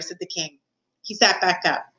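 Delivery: ordinary delivery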